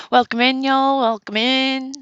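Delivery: a Tennessee welcome, big and bright; Tennessee accent. Shown as a demonstration. Streamer greeting chat in a Tennessee accent. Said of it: "That's me in my Tennessee accent".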